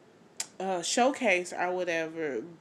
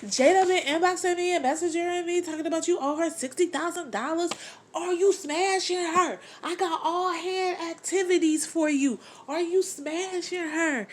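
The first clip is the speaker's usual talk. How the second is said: mock Jen; 8 semitones higher, 3 dB louder than usual. Jen confronts Fresh about Jada.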